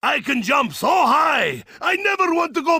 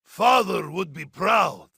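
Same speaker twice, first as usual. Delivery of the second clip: usual speaking voice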